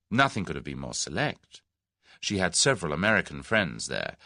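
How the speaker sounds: plain reading voice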